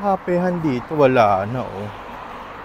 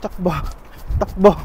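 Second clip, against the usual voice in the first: urgent running call; 6 semitones higher, 4 dB louder than usual. Real, not acted. Rushing across, calling to run.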